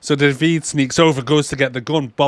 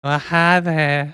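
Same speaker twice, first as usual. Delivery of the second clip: slow, high, drawn-out cartoon greeting; Bruce from Family Guy; 4 semitones higher, much slower than usual. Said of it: "He talks like Bruce off, uh, Family Guy".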